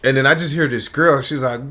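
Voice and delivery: ordinary talk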